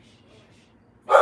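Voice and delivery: long unvoiced shushes; slow shushing. Real, not acted. To her dog Lacey at the door, slow soothing shushes. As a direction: soothe the dog quiet with slow shh sounds.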